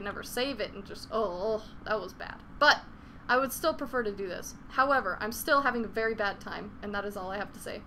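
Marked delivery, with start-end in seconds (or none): none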